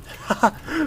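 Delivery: high gleeful cackle; gleeful giggle; gleeful laugh. Real, not acted. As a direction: laugh with delight. Laughs at the storm.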